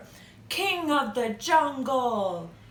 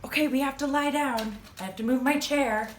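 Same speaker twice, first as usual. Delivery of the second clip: usual speaking voice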